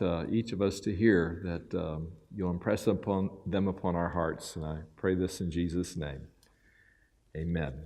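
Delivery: pastor praying before the congregation; prayer. Real, not acted.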